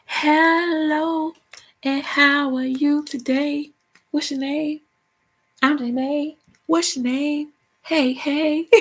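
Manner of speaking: high, sing-song greeting, slow at first; showing off singer voice. Pretend. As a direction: greet like a diva everyone hears. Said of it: "I would have been around here like".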